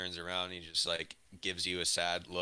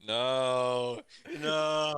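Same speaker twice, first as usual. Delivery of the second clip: loud, higher, drawn-out no; protesting no; 4 semitones higher, 6 dB louder than usual. Pretend. Player hears the drunk man will escort him, loud protest. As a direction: wail it in mock protest.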